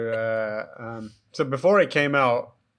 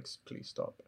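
breathless whispered please stop; 18 dB quieter than usual. Retold or imitated. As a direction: beg it to stop under your breath. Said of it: "constantly is like".

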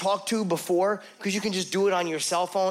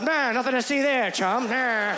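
1940s movie actor; 5 semitones higher than usual. Retold or imitated. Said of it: "I sound like an actor from the nineteen forties".

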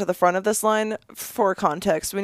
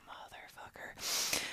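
whispered curse; 20 dB quieter, much slower than usual. Retold or imitated. Muttered curse in line.

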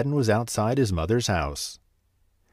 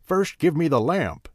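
angry demand; 6 semitones higher than usual. Acted. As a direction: bark the order at the boy. Sorcerer demands the lamp.

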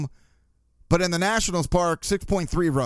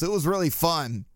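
ordinary delivery

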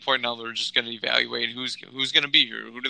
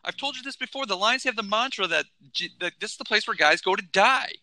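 heated rant; 6 semitones higher than usual. Acted. Ranting about Lions culture.

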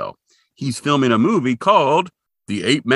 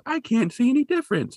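Shaggy; 12 semitones higher than usual. Retold or imitated. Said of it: "Shaggy starts laughing and says".

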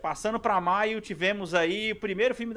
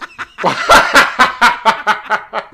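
big real laugh, much louder; loud burst of laughter; 4 semitones higher, 18 dB louder than usual. Real, not acted. Reacts to the lawsuit joke.

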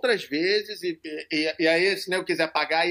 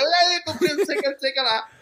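cumprimento zombeteiro em tom bem agudo; brincadeirinha imitada; 7 semitones higher, 3 dB louder, faster than usual. Retold or imitated. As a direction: imite o cara puxando brincadeira, agudo e animado. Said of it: "ficou de brincadeirinha comigo". (imitação leve de provocação brincalhona)

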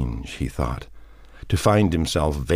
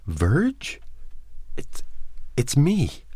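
high, hesitant, halting question; faltering; 11 semitones higher, much slower than usual. A narrator's reading. Man names himself in the darkness, faltering. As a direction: falter between the words, unsure. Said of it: "the faltering voice said".

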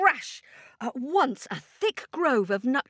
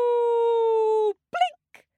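high loud whooping effect; a bright magic whoop; 12 semitones higher, 5 dB louder than usual. Acted. Storyteller making the magic lake appear with a high whoop.

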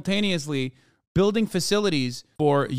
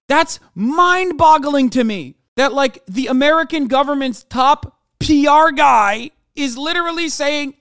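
louder and higher, slowed for emphasis; incredulous yell about the PR guy; outraged loud rant; 8 semitones higher, 7 dB louder than usual. Real, not acted. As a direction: hammer home the outrage. Ranting about government PR.

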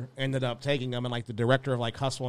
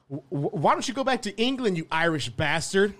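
high, stammering mock insult, much higher; stuttering cousin; 7 semitones higher, 5 dB louder than usual. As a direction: trip over the first word, then blurt the insult. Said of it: "stumble over his words and shit".

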